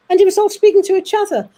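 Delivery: tumbling out with excitement; excited. Real, not acted. Telling the audience about the spirits all talking at once, excitedly. Excited retelling of many voices.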